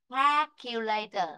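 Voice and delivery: "calculator" slowly with æ in the first syllable; teacher's careful "calculator", first vowel sliding from a to e; "calculator" opened with a spread "kae"; æ pronunciation. Shown as a demonstration. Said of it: "chúng ta nói sang âm e".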